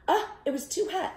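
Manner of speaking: high, fast startled cry; high quick surprised oh; too hot. Acted. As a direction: yelp as if scalded. Reacting to hot porridge.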